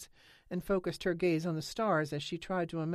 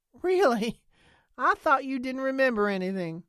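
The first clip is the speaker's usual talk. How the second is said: much higher and louder, incredulous; startled disbelief; 9 semitones higher, 10 dB louder than usual. A narrator's reading. Nance can't believe Lena remembers, loud and high. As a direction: burst out in disbelief.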